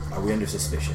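low, soft, tentative question; hesitant. Acted. Companion checking with the party after the deed, hesitant. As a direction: ask it carefully, keeping the voice down. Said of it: "with some hesitation in his voice".

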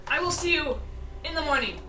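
loud parting line. Acted. Character ending the talk, loud and curt. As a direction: fling it out loud as she leaves.

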